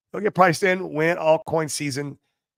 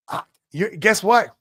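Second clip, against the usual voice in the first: normal talking voice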